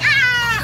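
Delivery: mock scream. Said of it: "She was all like".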